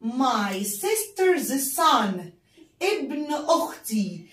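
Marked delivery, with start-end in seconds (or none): 0.0-2.2 my sister's son said very slowly; teacher showing how to say sister's son; slow model phrase. Shown as a demonstration. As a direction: drag it out word by word. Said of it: "لو عايزة أقول ابن أختي".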